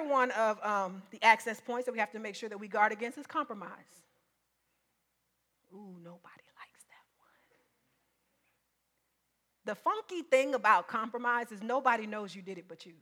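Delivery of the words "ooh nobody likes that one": hushed knowing whisper; whispered aside. Real, not acted. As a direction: drop to a whisper. Aside to the congregation.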